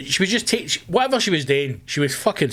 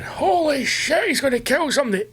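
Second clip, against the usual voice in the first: mock alarmed passenger; 12 semitones higher than usual. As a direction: squeak it like a shocked onlooker. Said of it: "or fucking".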